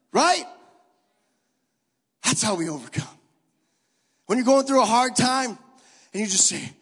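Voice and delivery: loud, fervent preaching to the room; preaching at full force. Acted. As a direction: stir the crowd, full voice. Preaching to the congregation.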